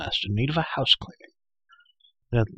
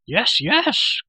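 high, loud creature yelps; crying-out Gurgi; 12 semitones higher, 5 dB louder than usual. A narrator's reading. Creature recognising the masked rider, crying out. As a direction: yelp both words high and loud. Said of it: "Gurgi cried".

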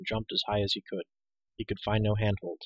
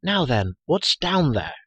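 raised voice, higher and louder; calling out; 6 semitones higher, 7 dB louder, slower than usual. A narrator's reading. Girl calling down the pit to the hero, calling out. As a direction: call down to someone below. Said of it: "Elinor called".

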